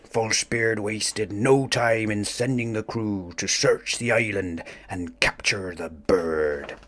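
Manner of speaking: usual speaking voice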